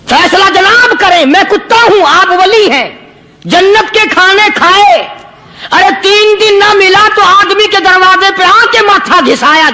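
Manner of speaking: talking dog. Retold or imitated. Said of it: "اللہ پاک نے کتے کو بھی زبان دی".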